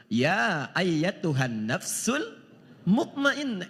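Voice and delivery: drawn-out opening of a recited verse; Quran recitation. Acted. Preacher reciting the verse to the congregation, drawn-out chant. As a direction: stretch the first words like tilawah.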